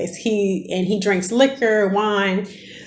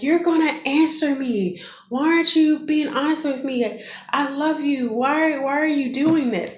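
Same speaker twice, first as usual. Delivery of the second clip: pleading confrontation; 7 semitones higher than usual. Retold or imitated. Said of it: "I acted outside of character".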